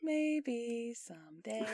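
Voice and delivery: slow breathy whisper. Real, not acted. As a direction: whisper it back slowly. Whispered repeat of maybe someday.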